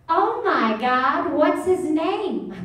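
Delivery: slow, high, gushing oh my God; mock a gushing dog lover; gushing woman impression. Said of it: "this is what she does".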